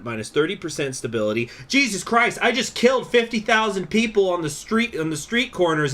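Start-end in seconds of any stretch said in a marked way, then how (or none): 1.7-5.9 high, loud, stunned disbelief; blurting out in horror; shocked outburst. Real, not acted. Shocked at his own massacre.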